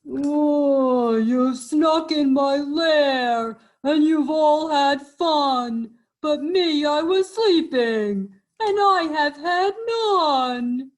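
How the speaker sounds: loud, high, slow blubbering bear; hammed-up tearful complaint; wailing bear. A narrator's reading. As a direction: wail it like a big sulking bear. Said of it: "He whimpers and he moans, he wails".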